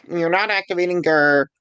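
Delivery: usual speaking voice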